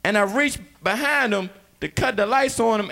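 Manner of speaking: ordinary delivery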